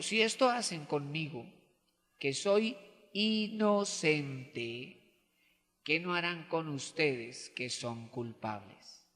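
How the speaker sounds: low, soft, very slow phrases; grave, hushed warning. Acted. Innocent Jesus warning the guilty, grave and quiet. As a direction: drop right down and space each phrase.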